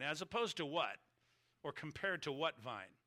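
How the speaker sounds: ordinary talk, nothing marked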